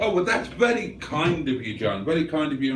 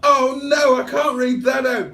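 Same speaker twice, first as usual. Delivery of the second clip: high, loud cry of refusal; playfully scandalised, loud and high; mock dismay; 10 semitones higher, 5 dB louder than usual. Real, not acted. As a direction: balk out loud, pitched right up. Mock dismay at a rude message.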